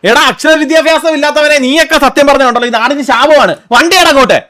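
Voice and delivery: angry scolding. Acted. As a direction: berate him, ending on a loud order. Insulting driver, ordering him to drive. (ദേഷ്യത്തിൽ ഉയർന്ന സ്വരത്തിൽ ചീത്ത വിളിക്കുന്നു)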